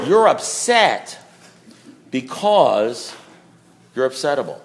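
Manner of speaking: slow, with long pauses between phrases; imitating a sponsor's measured punchline; deliberate pronouncement. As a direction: hand down the answer piece by piece. Sponsor's punchline, delivered slowly.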